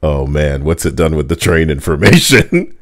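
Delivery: ordinary talk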